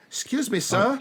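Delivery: high-pitched little Cockney orphan. Acted. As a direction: beg timidly as a small Cockney urchin. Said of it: "a Cockney accent as done by a young orphan".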